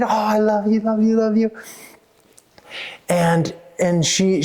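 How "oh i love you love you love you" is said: put-on a lightly high little-girl coo. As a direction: coo over the puppy like a child. Girl stroking the puppy.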